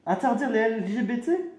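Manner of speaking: high, incredulous question; shocked disbelief. Acted. Rival reacting to fascist proposal, high-pitched disbelief.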